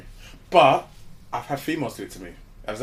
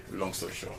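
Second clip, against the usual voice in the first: much lower and far softer, thrown away; softer low deadpan repeat; muttered aside; 7 semitones lower, 12 dB quieter than usual. Shown as a demonstration. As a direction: drop it under your breath as a wry echo.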